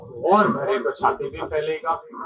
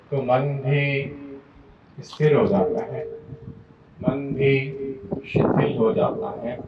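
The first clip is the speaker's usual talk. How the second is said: guided meditation. Real, not acted. Yoga teacher guiding students to still body and breath, calm meditative delivery. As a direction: lead them gently into stillness, unhurried.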